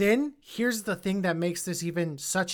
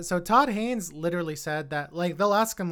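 ordinary talk

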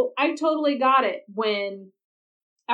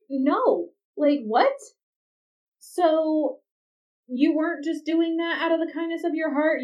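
higher, louder, stung and incredulous; indignant disbelief; 7 semitones higher, 3 dB louder than usual. Retold or imitated. Husband confronting Claire, higher and louder in hurt disbelief. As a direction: throw the question back at her, hurt and outraged. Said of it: "he's got his defenses back up".

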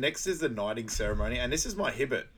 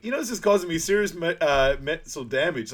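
mock distress; 6 semitones higher, 7 dB louder than usual. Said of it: "He's like".

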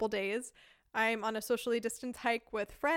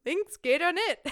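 higher, louder, slow Badisch repeat; 6 semitones higher, 6 dB louder than usual. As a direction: repeat it stubbornly in dialect. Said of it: "she just keeps repeating to me".

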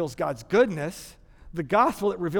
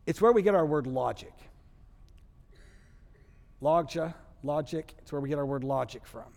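ordinary talk, nothing marked

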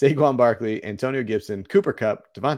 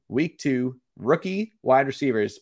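ordinary talk, nothing marked

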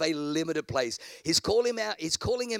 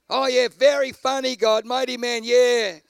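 loud mock sarcasm, higher; sarcastic; 5 semitones higher, 9 dB louder than usual. Gideon mocking God's praise, loud and sarcastic. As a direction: roll the eyes through the words.

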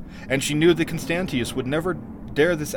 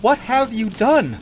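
higher, slower, more anguished demand; drawn-out distressed cry; 9 semitones higher, 5 dB louder, slower than usual. A narrator's reading. Queen demanding an answer from her sister, crying out slowly. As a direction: stretch it out in appalled alarm. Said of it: "cried Taramis".

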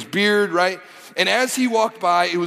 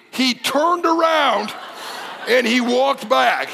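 amazed build; 7 semitones higher, slower than usual. Real, not acted. Amazed retelling of return.

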